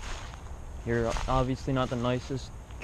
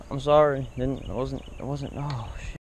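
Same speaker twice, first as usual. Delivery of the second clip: ordinary talk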